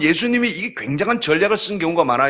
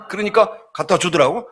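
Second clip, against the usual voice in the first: normal talking voice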